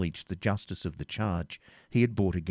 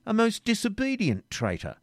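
smiling teasing; 12 semitones higher than usual. A narrator's reading.

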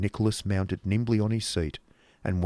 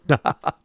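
schoolmaster's chuckle; 7 semitones higher than usual. Acted. Schoolmaster amused by his own joke, coarse chuckle. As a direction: laugh briefly at your own joke.

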